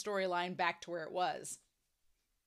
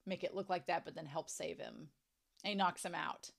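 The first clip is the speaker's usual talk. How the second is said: ordinary talk, nothing marked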